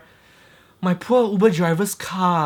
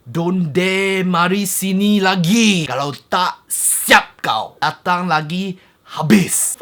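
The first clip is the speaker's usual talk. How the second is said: mock angry threat; 4 semitones higher, 4 dB louder, slower than usual. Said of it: "He started saying".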